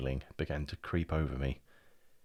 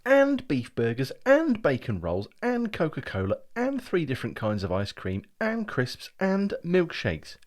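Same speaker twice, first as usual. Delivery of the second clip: higher, louder, slow relishing list; gleeful listing; 9 semitones higher, 6 dB louder than usual. Acted. Brian listing the treats to his brother, gleeful. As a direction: savour each treat.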